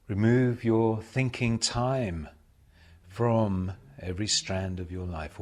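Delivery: ordinary delivery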